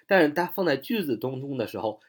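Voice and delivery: usual speaking voice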